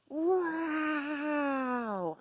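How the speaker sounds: long high loud wail; imitated screaming. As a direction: mimic a drawn-out screaming howl. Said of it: "she's just screaming".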